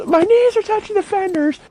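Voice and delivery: high, strained whining about being stuck; whiny complaint. Retold or imitated. Buddy complaining to friend about the cramped tractor, high whine. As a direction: complain like a big guy crammed in a tiny seat. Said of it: "He goes".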